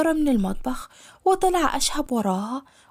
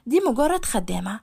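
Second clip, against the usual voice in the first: ordinary talk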